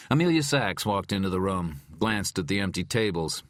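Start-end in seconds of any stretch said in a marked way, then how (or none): none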